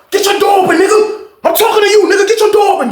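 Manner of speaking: high, insistent imitated challenge; retold furious demand, very high; acted angry demand. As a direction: call him out repeatedly, pitch way up. Acts out quarrel before fight.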